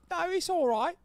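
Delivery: high hammed-up British accent; squeaky mock Cockney copper. Streamer mocks British police in high British accent. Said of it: "British authorities saw that and were like".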